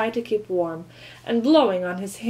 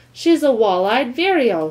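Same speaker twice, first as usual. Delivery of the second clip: scientifically; 3 semitones higher, 7 dB louder than usual. A narrator's reading. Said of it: "said George scientifically".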